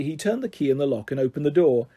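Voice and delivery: usual speaking voice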